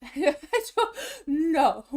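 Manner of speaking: laughing out a no. Real, not acted. Vlogger laughing at the checkout prompt. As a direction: giggle through the refusal.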